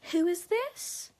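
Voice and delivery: high, loud, drawn-out mimicry; exaggerated high questioning impression of him; mocking imitation. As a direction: swoop up slowly in mock cluelessness. Mimicking his dismissive answer to call.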